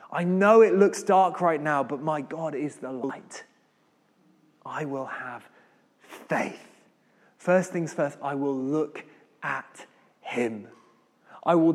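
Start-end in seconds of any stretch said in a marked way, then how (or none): 4.6-6.7 soft, whispered commitment; a believer quietly resolving to trust; hushed resolve. Acted. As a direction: let it fall to a whisper. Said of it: "quiet resolve".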